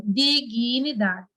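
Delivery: "dignidade" slowly, syllable by syllable; slow sounding-out. Shown as a demonstration. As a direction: stretch out each part of "dignidade". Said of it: "Deixa eu colocar aqui essa palavra".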